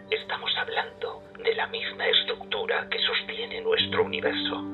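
voiceless, whispery delivery; hushed confiding. Acted. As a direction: confide a secret theory in a husky whisper. Professor confides forbidden theory to doctor.

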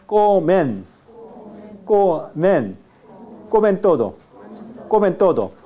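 "comen" ending with tongue on upper teeth; dental n. Shown as a demonstration. Teacher has class repeat, n pronounced dental. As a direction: drill "comen todo", n touching the teeth. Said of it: "La lengua debe tocar los dientes superiores".

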